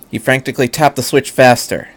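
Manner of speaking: ordinary delivery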